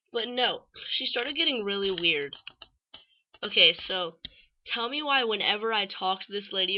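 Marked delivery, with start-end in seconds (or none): none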